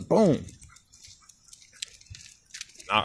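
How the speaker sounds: normal talking voice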